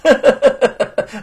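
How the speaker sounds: loud, high smug laugh; gloating laugh. Acted. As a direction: laugh in triumph. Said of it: "得意地说". (得意的大笑)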